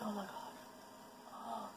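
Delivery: slow, breathless shocked whisper. Retold or imitated. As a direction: whisper it in disbelief. Retold shocked reaction.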